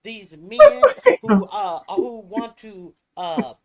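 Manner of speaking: loud, high heated rant; angry. Real, not acted. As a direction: rant with rising fury.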